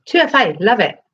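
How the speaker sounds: bright, lifted burst of delight; excited. Real, not acted. Delighted reaction to comment.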